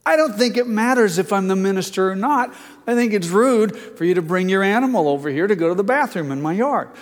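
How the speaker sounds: a preacher snapping back at a rude neighbor; heated reply. Retold or imitated. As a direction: push back hard at the neighbor. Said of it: "I turned around and I said".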